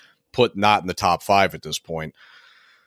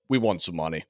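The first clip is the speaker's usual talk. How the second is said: usual speaking voice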